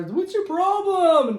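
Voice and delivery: high, drawn-out mock mom voice; retold motherly complaint, high and slow; imitated exasperated mom. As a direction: stretch it out high, like a mom fed up.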